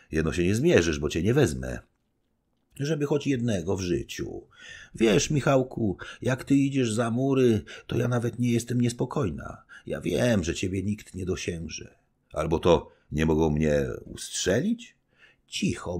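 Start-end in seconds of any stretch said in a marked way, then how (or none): 2.7-11.9 higher, doting wifely voice; fond young wife. Acted. Young wife telling her husband she never worries, doting. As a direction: reassure him, proud of him.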